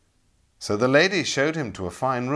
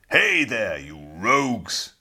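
Ilya calls out louder to the robbers, a rough hail; crying out; 4 dB louder, slower than usual. A narrator's reading. Knight hails the robbers attacking him, loud bold cry. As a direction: call out to the rogues, bold and loud. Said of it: "cried Ilya".

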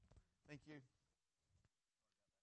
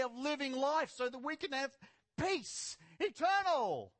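loud pulpit proclamation building to a high final cry; forceful preaching; 6 semitones higher, 5 dB louder, slower than usual. Real, not acted.

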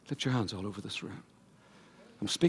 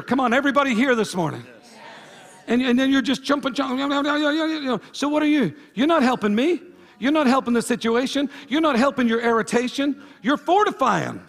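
loud, driving preacher cadence; full-force preaching; 12 semitones higher, 9 dB louder than usual. Acted. Pastor pressing the congregation about irritation, preaching loud.

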